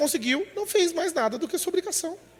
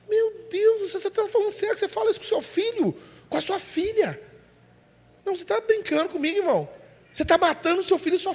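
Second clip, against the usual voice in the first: higher, disbelieving outcry; he can't believe what he hears, voice up high; incredulous; 6 semitones higher than usual. Real, not acted. Indignação do pregador. (pregador incrédulo, voz bem mais aguda)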